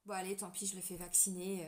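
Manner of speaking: low, quiet, resigned shrug of a voice; resigned giving in. Acted. Imagined parent giving in on the vaccine, resigned.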